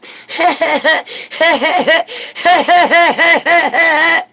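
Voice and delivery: high shrill fake cackle; over-the-top mocking laugh; mock hysterical laugh. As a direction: cackle like a giddy churchgoer. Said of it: "laugh like this".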